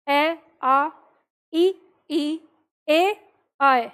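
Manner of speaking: ए, आ, ई, ऐ each said as a separate pure vowel; vowels pronounced independently. Shown as a demonstration. Teacher demonstrates standalone vowels, ए, आ, ई, ऐ sounded separately. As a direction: sound out ए, आ, ई, ऐ one by one. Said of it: "स्वतंत्र रूप से उच्चारण करके देख लीजिए".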